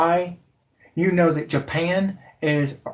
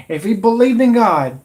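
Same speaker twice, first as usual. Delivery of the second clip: shouted much louder and higher; angry shout; 7 semitones higher, 7 dB louder than usual. Real, not acted. Ranting at the president, angry shout. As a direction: blast it out in outrage.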